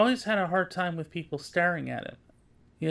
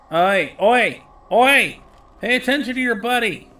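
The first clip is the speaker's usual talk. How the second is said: loud calling out, higher on the oi; shouting oi; 4 semitones higher, 13 dB louder, slower than usual. Real, not acted. Player yelling at a wandering teammate, loud shout. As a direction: yell to get the buddy's attention.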